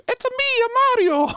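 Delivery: very high, loud cartoon Italian accent; squeaky-high mock Italian video game hero; Mario. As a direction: sing out a hammed-up Italian accent, high and excited. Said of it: "He doesn't do the Italian accent".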